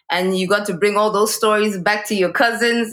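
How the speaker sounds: ordinary talk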